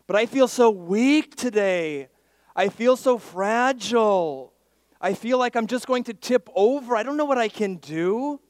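loud, high cry of helplessness; anguished complaint. Acted. Preacher voices a believer feeling fragile, loud complaint.

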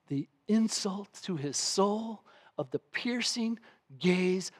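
soft, hushed and slowed; hushed reflection. Real, not acted. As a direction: draw them close, grave and low.

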